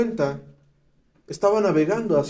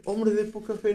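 wrong way; 3 semitones higher, 7 dB quieter than usual. Shown as a demonstration. Preacher showing how Jesus did not say it, soft and offhand. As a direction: toss "hombre de poca fe" away softly. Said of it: "ni siquiera fue de".

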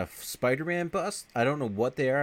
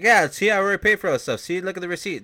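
higher, louder, fast smug voice; hammed-up smug bum; 9 semitones higher, 7 dB louder, faster than usual. Bert waving a receipt at the manager, fast and high.

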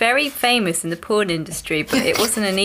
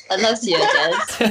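laughing Bristol accent; mock drunk Bristol accent; 2 semitones lower than usual. Shown as a demonstration. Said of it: "Bristolian accent".

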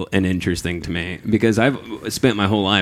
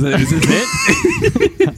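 high slow exaggerated question; playful high-pitched tease; mock excited; 4 dB louder, much slower than usual. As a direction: stretch it out in a squeaky high voice. Playful blooper fanfare.

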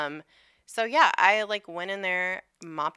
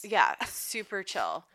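slow mocking "super chill"; sarcastic drawl; 3 semitones higher, slower than usual. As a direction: drag it out, mock breezy. Sarcastic echo of the boss.

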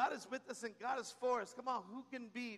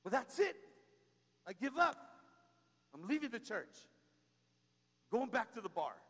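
mock fed-up churchgoer, loud at first; quitter's outburst; 3 semitones higher, 5 dB louder than usual. Churchgoer quitting in a huff, mock-angry. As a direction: throw up your hands and quit. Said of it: "That's the stupidest thing".

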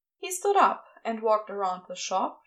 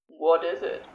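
Crowley voice; 6 semitones lower than usual. Acted.

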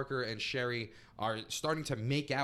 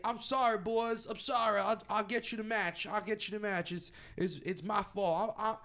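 high, far put-on voice of a smitten manager pleading; lovesick Colonel; 11 semitones higher than usual. Manager to his wrestlers, pleading he's in love, high lovesick voice. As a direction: moon over the woman in a high whiny put-on voice. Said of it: "And it".

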